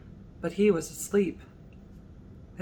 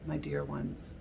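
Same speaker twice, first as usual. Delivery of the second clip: softer, hushed comfort; tender reassurance; 10 dB quieter than usual. Retold or imitated. Jesus reassuring the congregation, soft tender reassurance. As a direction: soothe frightened loved ones. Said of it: "remember Jesus saying to us".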